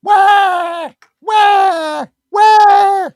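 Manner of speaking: loud, high fake crying wail; mock baby wailing. Host mocking opponents as crybabies, loud fake wail. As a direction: bawl like a whiny baby. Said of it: "Whine, cry".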